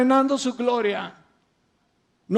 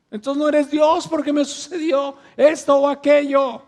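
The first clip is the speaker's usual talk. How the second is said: provoking complaint; 4 semitones higher, 6 dB louder than usual. Retold or imitated. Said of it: "lo seguimos provocando".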